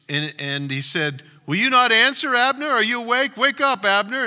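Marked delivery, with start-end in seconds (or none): 1.5-4.2 calling out loud and high from a hilltop; high, loud call across the valley; shouting across a distance. Acted. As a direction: shout down to the sleeping army. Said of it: "he shouted down to the army".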